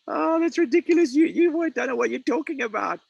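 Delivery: high, loud jeering ridicule; scoffing crowd. Acted. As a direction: sneer at him like he's a fool.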